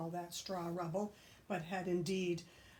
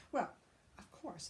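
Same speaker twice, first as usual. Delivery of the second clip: ordinary delivery, nothing marked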